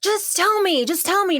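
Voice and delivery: high, insistent girlfriend imitation; mock pleading. Acting out girlfriend begging for confession.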